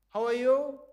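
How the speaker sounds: called out loud to someone in a box. Shown as a demonstration. Teacher knocking on a box, loud call.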